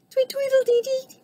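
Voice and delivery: very high, tweeting bird voice; twittering bird. A narrator's reading. Bird joins the band, high twitter. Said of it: "he twittered".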